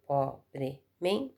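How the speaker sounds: "pobremente" spoken slowly, syllable by syllable as po-bre-men-te; teacher slowly chopping "pobremente" into po-bre-men-te; split into syllables. Shown as a demonstration. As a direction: sound out "pobremente" in four slow, separate beats. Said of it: "E vocês vão separá-las assim".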